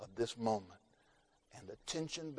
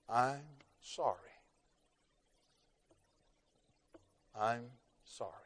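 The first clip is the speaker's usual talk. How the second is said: understated deathbed apology, trailing softer; retold tender apology; 6 dB quieter than usual. Dying father's apology, retold.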